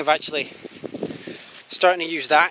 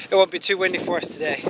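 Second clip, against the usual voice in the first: usual speaking voice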